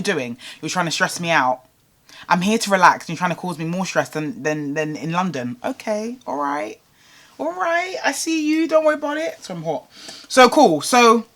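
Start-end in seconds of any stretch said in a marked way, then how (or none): 5.6-9.4 high, soft sing-along sarcasm; mock acceptance. Holidaymaker pretending to accept being stressed out, mock-sweet.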